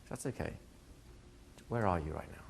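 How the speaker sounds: soft, unhurried coach voice; imitating a calm, soft-spoken coach; gentle reassurance. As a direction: reassure quietly, then gently ask. Voicing coach reassuring a client.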